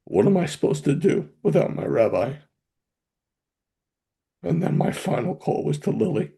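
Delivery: very high, choked, real tearful voice. Real, not acted. Father repeating his children's pleas at goodbye, tearful. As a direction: strain the words out through tears, with halting pauses. Retelling children's last words to him.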